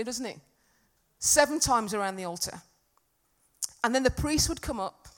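ordinary talk

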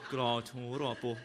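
low attempt at a Birmingham accent; a lightly done Birmingham voice. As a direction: try a Brummie accent and laugh through it. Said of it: "Birmingham accent".

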